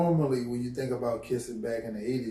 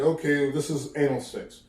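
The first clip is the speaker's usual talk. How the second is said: mock parent lecture; 7 dB louder than usual. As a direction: lecture the son like a blunt father. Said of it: "Your parents is not gonna sit there and tell you".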